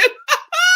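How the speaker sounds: high teary laugh, half crying; tearful laugh. Real, not acted. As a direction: laugh through the tears. Laughs, tearful, after story.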